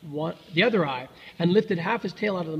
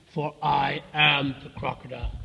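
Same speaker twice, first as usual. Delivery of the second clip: low, slow, weighty crocodile; 4 semitones lower than usual. A narrator's reading. Crocodile revealing himself to the elephant's child, low and slow. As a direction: announce yourself slowly in a lowered voice.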